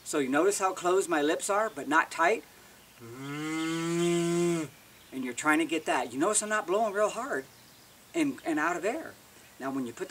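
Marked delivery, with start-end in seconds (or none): 3.0-4.7 long steady lip-buzzed elk scream; mouth-made cow elk scream without the call; lip ball scream. Shown as a demonstration. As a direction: hold one tone through closed lips, not tight. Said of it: "A lip ball scream is kind of done without the call in your mouth".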